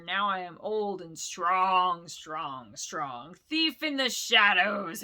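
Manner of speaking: loud, slow, booming dragon roar; boasting dragon. Acted. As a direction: thunder out the boast with full menace.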